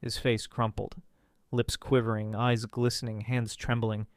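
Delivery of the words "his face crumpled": ordinary delivery, nothing marked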